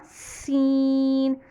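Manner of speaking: सीन stretched very slowly, the स hissed with teeth together; drawn-out seen. Shown as a demonstration. Teacher reading the letter name slowly for students. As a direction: draw सीन out long and slow. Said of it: "तो सीन जो पढ़ते हैं हम इस तरह".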